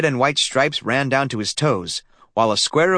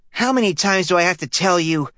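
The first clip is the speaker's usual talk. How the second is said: put-on higher boy's voice, fed-up and cutting in; exasperated interruption; 7 semitones higher than usual. A narrator's reading. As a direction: cut in, sick of repeating yourself. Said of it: "interrupted Stanley".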